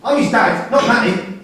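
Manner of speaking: loud, high insistence; angry shout. Acted. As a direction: shout it, stake your claim. Jealous father in prison visit.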